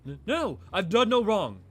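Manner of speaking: desperate denial. Acted. Accused man protesting his innocence to inquisitors, loud and desperate. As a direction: cry out your innocence.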